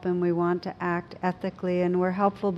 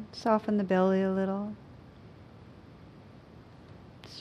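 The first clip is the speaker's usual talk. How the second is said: guided meditation. Acted. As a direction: gently guide them inward.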